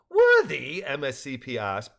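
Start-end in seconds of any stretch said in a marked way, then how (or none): 0.1-0.8 high, loud questioning echo; incredulous. A narrator's reading. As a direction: throw the word back in disbelief. Character incredulous at friend's modesty.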